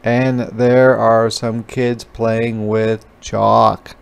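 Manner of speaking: ordinary delivery, nothing marked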